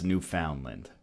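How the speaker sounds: "Newfoundland" with a full "found" vowel; unheard-of pronunciation. Shown as a demonstration. Never heard it this way: "Newfoundland" as new-FOUND-land. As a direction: stress FOUND in "Newfoundland", rhyming with "round". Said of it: "I've never heard it pronounced".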